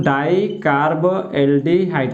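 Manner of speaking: डाइकार्बोल्डिहाइड at half speed, each part separate; measured dictation of डाइकार्बोल्डिहाइड for students; piece-by-piece dictation. Shown as a demonstration. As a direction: slowly break डाइकार्बोल्डिहाइड into its parts. Teacher repeats the term in pieces.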